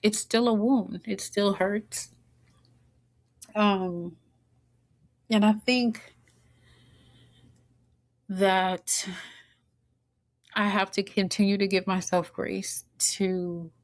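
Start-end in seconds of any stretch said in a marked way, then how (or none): 0.0-9.1 fighting tears as the hurt surfaces; tearing up. Real, not acted. Mother speaking of her absent father, tearing up. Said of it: "right now, I'm tearing up".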